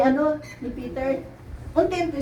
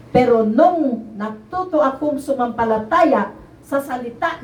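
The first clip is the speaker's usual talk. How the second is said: preaching; 5 semitones higher, 5 dB louder than usual. Acted. Testifying to the congregation, preaching at full force.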